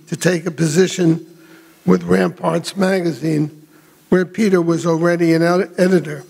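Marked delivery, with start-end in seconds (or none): none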